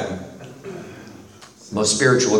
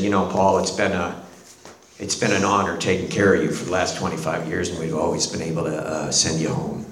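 gentle doctor; 6 semitones lower, 5 dB quieter than usual. Retold or imitated. Doctor kneels at dying patient's bed.

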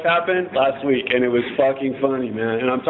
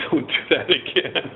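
slow, deliberate mock scolding; slow stern warning; 2 semitones higher than usual. Officers warning the teen at his parents' house, slow mock scolding. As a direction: drag it out like a cop lecturing a kid. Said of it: "and said".

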